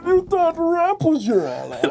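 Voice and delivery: high, slow, laughing Bane parody; mock Bane. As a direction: quote the villain line as a goofy boast.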